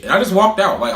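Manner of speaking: ordinary talk, nothing marked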